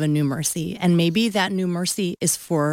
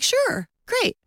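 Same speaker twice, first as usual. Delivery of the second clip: lightly voiced agreeable husband, pitched up; husband's agreeable reply. Husband agreeing to walk her there, easy agreement. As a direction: agree easily, high and breezy. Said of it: "he's like".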